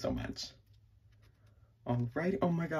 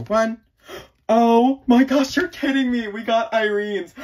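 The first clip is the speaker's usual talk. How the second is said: much higher and louder, slow, gasping delight; ecstatic scream; 11 semitones higher, 10 dB louder than usual. Real, not acted. Revealing the photo card to viewers, screaming with joy.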